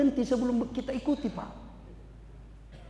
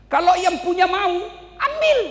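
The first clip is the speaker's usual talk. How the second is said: building to a high shout; rising shout; 8 semitones higher, 5 dB louder than usual. Acted. Preacher as Ayub shouting that the owner may take it back. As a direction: cry it out as the owner's right. Climax of Ayub's reply.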